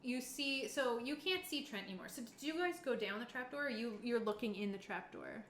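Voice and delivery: ordinary delivery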